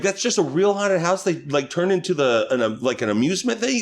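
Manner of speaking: high, incredulous wondering question; mock amazement. Man letting lady tell incorrect facts, high mock amazement. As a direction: draw her out with put-on astonishment. Feigns amazement at haunted house.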